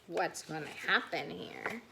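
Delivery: ordinary delivery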